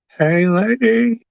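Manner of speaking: high, slow, put-on clown squeal; silly clown voice. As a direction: stretch it out like a goofy clown. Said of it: "my clown voice".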